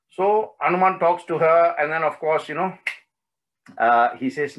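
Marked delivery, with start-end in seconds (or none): none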